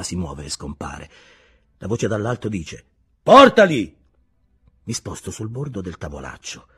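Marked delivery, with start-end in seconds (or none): 3.2-3.9 high, loud shout; barked order. A narrator's reading. Invisible boss ordering the artists brought up, loud shout. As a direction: snap it out as a command from above.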